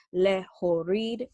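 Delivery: "lehavin" said slowly, syllable by syllable. Shown as a demonstration. Teacher counting syllables in "lehavin" for students, slow. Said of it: "three syllables".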